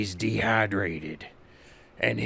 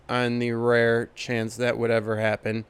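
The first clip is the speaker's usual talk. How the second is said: ordinary talk, nothing marked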